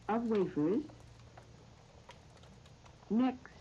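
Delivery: slow, measured phrases with long pauses; recipe dictation. Real, not acted. Radio host reading out a recipe to listeners, slow dictation. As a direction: dictate it piece by piece, leaving time to write.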